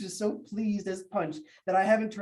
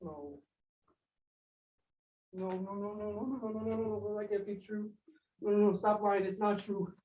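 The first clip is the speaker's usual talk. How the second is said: soft, repeated disbelieving no; quiet broken denial over the dog; stunned denial; 4 dB quieter than usual. Acted. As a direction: murmur the denial as it sinks in. Denying the dog's death.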